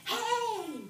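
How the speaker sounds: high, loud storybook hello; exclaimed greeting. Acted. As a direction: call out like a boy hailing a friend. Voicing boy's greeting in book.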